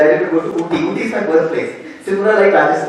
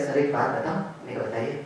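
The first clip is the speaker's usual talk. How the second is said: confiding hush; 4 semitones lower, 12 dB quieter, much faster than usual. Retold or imitated. Student confides about his wife.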